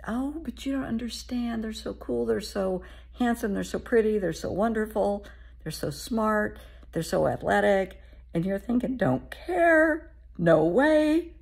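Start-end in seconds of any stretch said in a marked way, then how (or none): 0.1-8.0 put-on higher and louder, gushing like a smitten teenager; teen defending a crush to a parent, gushing and higher. 9.0-11.3 much higher and louder, flat refusal; firm parent refusal. Retold or imitated.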